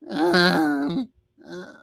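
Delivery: mock wordless mumble and groan; imitated mumbling. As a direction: imitate someone straining out a mumble. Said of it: "all he did was go".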